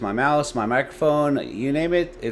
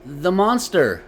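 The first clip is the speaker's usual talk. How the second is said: put-on higher small boy voice; scared boy; 8 semitones higher, 4 dB louder, slower than usual. A narrator's reading. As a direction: say it like a frightened kid. Boy repeats.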